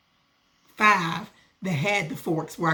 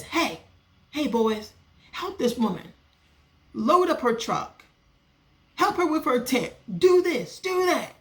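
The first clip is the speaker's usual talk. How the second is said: higher, bossy ordering-about voice; bossy neighbor woman; 6 semitones higher than usual. Retold or imitated. Neighbor vendor ordering boys to load truck, mimicked high and bossy. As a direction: mimic her sudden eager orders. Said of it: "the woman says".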